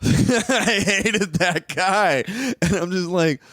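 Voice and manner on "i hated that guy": laughing. Real, not acted. Laughing while saying it.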